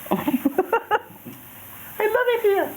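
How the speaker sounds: playful fake Jamaican, high; fake Jamaican accent. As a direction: squeal it in a hammed-up Jamaican lilt. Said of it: "You like my fake Jamaican accent?".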